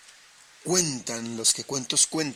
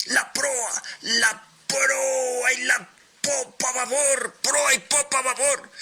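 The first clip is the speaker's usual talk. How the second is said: captain's shout; 11 semitones higher than usual. A narrator's reading. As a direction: bellow the command to the crew above the wind. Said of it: "Y el capitán".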